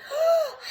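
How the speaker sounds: high sharp gasp; gasp before sneeze. Acted. Sneeze building up.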